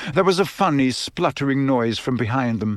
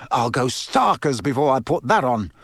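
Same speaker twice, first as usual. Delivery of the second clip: plain reading voice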